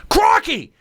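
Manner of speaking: high-pitched exclamation in an Australian accent. Shown as a demonstration. Host shows colleagues an accent key phrase, high Australian accent. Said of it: "For Australian, it's really easy".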